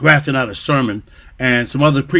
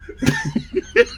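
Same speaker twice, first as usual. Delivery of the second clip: high laugh; 5 dB quieter than usual. Real, not acted. Laughs at joke.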